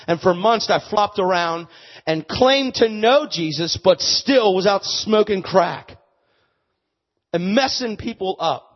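loud, high preaching at full force; fiery preaching. Acted. Preacher testifies to his congregation, loud and fervent. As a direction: drive it home to the crowd.

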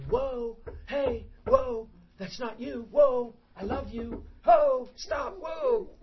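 a hint of a loving God saying whoa. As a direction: gently call someone back from danger. God's voice gently stopping him.

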